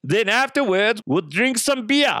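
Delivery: put-on high comic German accent; German swim instructor. German instructor promising beer afterwards, mock German accent. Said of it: "German swim instructor".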